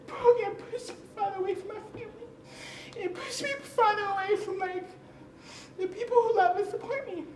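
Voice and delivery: high, tearful voice breaking into a cry; choked up, close to crying. Real, not acted. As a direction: speak through real tears. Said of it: "And I'm crying".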